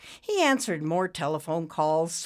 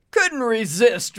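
drawn-out, loud, higher jeer; snorting mockery; 7 semitones higher, 9 dB louder, slower than usual. A narrator's reading. Ricky to the man he toppled, snorting mockery. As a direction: snort it out, mocking. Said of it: "Ricky said, snorting".

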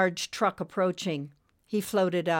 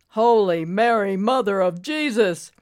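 calling out; 3 semitones higher, 8 dB louder than usual. A narrator's reading. Exhausted trucker calls out while pumping the chest, loud. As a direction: boom it out like a desperate prayer. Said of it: "He then called out".

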